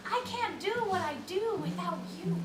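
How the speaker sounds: ordinary talk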